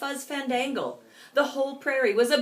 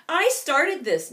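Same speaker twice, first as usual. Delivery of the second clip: moaning; 4 semitones higher, 5 dB louder than usual. Acted. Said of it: "moaned Pipsqueak".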